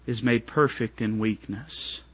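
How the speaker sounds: hushed, reverent reading of God's promise; solemn and tender. Acted. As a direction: let the verse settle gently. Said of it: "I want you to notice this".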